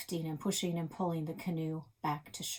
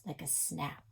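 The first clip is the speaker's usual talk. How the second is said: plain reading voice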